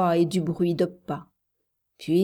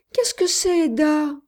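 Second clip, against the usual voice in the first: put-on high, loud child's cry; terrified child; 9 semitones higher, 5 dB louder than usual. A narrator's reading. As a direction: question cried out like a frightened child. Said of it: "sanglota l'enfant terrifié". (voix aiguë d'enfant terrifié)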